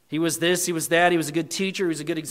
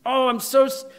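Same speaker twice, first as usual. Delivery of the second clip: ordinary talk, nothing marked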